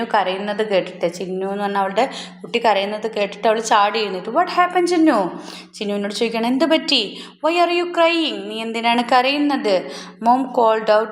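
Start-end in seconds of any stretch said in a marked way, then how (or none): 4.3-9.9 high, worried mother asking what happened; alarmed mother. Acted. Mother goat asks her crying kid what happened, high and worried. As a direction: rush to the crying child with worry.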